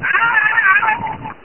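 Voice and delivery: sudden high startled yelp. Retold or imitated. Listener imagining a mic tap in his earbuds, high startled yelp. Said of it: "'cause I would have went".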